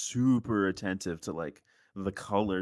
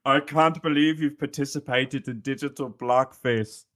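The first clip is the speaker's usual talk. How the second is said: higher, louder mock scold of a woman; 4 semitones higher, 4 dB louder than usual. Friend imitates a woman scolding about digital blackface, mock outrage. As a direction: ham up her outraged scolding. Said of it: "how she said it".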